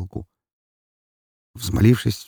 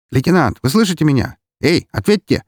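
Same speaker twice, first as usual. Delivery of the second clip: high, louder anxious radio call; alarmed; 8 semitones higher, 5 dB louder than usual. A narrator's reading. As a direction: call urgently for a reply. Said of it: "раздался встревоженный голос по рации".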